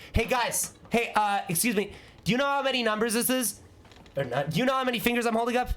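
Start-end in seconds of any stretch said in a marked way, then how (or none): 0.1-3.6 high, chirpy pestering questions; mock taunting. Pestering a blind person for fun, mock taunting. 4.5-5.7 high, rapid-fire pestering question; mock taunting. Quizzing a blind person on fingers, mock taunting.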